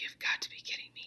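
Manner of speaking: breathy whisper to yourself; whispered exasperation. Retold or imitated. Teacher imitating herself arriving at school without the item, whispered. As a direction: mutter it under your breath in disbelief. Recalls forgetting item at home.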